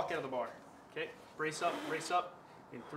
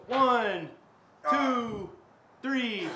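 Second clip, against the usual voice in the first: loud counting; 8 semitones higher, 7 dB louder, much slower than usual. Real, not acted. Counting reps under strain.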